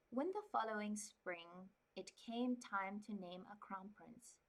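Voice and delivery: calm, even storybook reading; story narration. Acted. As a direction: read it like a quiet novel. Reads novel aloud.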